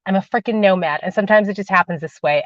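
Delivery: usual speaking voice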